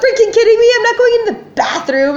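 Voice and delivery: acting out a shrieked, indignant refusal; screaming. Patient refusing to inject in an airport bathroom, screaming. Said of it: "but I'm screaming at her".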